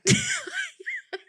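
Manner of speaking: high-pitched burst of laughter; high giggle. Real, not acted. Host ends her embarrassing nail story, laughing high. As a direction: crack up at your own punchline.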